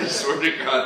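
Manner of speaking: normal talking voice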